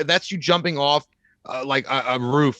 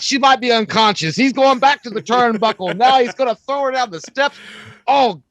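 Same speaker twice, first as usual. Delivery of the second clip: higher, excited ringside call; playful play-by-play, higher and louder; mock wrestling commentary; 7 semitones higher, 3 dB louder than usual. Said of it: "Ultimate Warrior fighting Hulk Hogan".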